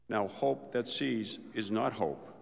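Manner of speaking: ordinary talk, nothing marked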